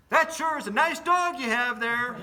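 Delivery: high, loud, slow put-on friendly stranger; panhandler's voice. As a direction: lay on the charm, slow and loud. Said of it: "He goes".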